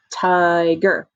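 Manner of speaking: "Tiger" stretched over two even beats; split into syllables. Shown as a demonstration. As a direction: sound out "Tiger" syllable by syllable, TI-GER. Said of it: "Or syllables".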